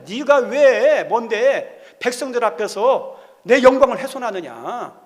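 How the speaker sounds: slow, loud scolding as God; acted divine rebuke. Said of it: "하면서 모세를 책망했어요". (하나님이 모세를 책망하듯 크고 느리게 꾸짖는 연기)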